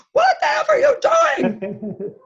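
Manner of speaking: high, loud, laughing mock yell; retold producer scream. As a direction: belt it like a frantic producer in her ear. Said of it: "The producer seven times screams".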